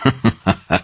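the teacher's satisfied little laugh; acted chuckle. A narrator's reading. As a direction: chuckle on after the line, in character. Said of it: "با خنده گفت".

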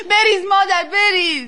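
loud, high motherly outburst; distraught urging. Acted. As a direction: call out permission with too much feeling. Distraught mother urges child on.

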